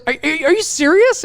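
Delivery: high, shocked question; disbelieving boss. Retold or imitated. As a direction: sound floored by the news.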